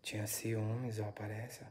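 breathy whispered aside to the camera; barely audible ghost-hunt whisper; hushed whisper. Real, not acted. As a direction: whisper it so the spirit isn't disturbed. Whispering a spirit's answer to viewers.

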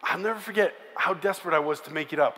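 ordinary talk, nothing marked

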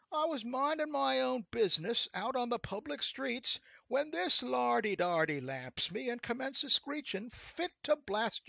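high, loud complaining voice; whiny rascal. A narrator's reading. Rascal pleads his innocence to the devil, whiny. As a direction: whine out a wronged man's tale. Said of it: "said the rascal in a whiny voice".

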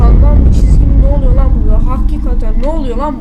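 loud shocked shout of alarm; real scared outburst, calling out loud; startled yelling. Real, not acted. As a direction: yell it out as the blood trail spooks you. Spots a blood trail, yells.

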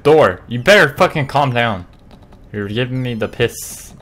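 normal talking voice

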